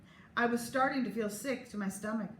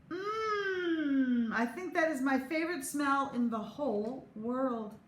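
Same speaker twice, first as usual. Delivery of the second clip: dreamy higher sigh of delight; savoring; 5 semitones higher, slower than usual. Acted. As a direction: melt over the smell. Savoring brownie smell.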